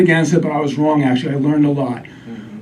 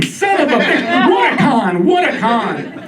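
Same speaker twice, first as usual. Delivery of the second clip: higher, slowed indignant outburst; mock outrage; 6 semitones higher, slower than usual. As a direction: explode like you've been conned. Outrage at being scammed.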